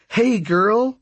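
high, affectionate greeting to a dog; excited greeting. Acted. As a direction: greet a beloved dog brightly. Brooks greets the dog, laughing.

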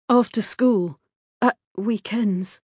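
ordinary delivery

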